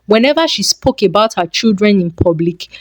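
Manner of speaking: ordinary delivery, nothing marked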